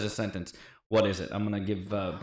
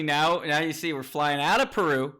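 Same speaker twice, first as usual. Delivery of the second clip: ordinary talk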